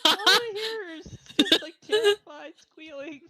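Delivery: loud, high, half-laughed remark; laughing hard. Real, not acted. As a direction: push it out over the laughter. Game master cracking up with players.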